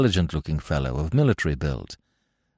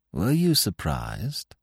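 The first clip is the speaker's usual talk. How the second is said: slow, higher, loaded question; subtly changed tone; 5 semitones higher, 4 dB quieter, much slower than usual. A narrator's reading. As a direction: ask slowly, with veiled significance. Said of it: "subtly changed the tone of his voice".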